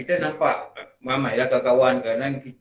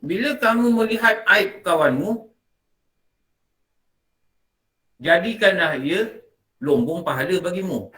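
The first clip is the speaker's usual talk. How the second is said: slow, raised recitation of a saying; quoting Hamka; 4 semitones higher than usual. Shown as a demonstration. Teacher reciting Hamka's saying to the class, slow and raised. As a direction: recite the quote slowly and solemnly. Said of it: "Dia kata apa?".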